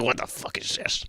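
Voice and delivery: a breathy, unvoiced mock whisper of a confused guy. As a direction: whisper it as if he is baffled. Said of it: "He's probably like".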